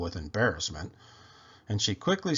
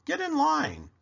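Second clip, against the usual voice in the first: Duke's order. Acted. Duke orders.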